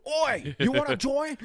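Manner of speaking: high shouted British hooligan call; soccer hooligan. Pretend. Pretend surgeon calls out like a Manchester hooligan. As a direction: yell it like a football lout on the terraces. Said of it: "pretend to be a soccer hooligan and go".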